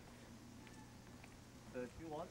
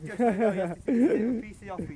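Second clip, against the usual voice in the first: mock outburst; 5 semitones higher, 13 dB louder than usual. Mock threat to friend.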